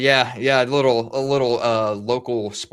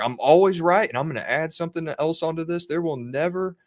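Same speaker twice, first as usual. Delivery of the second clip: higher-pitched mock pronouncement; grandly self-certain, pitched up; cocksure proclamation; 6 semitones higher than usual. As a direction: imitate a know-it-all making a bold prediction. Mimics radio host's bold proclamation.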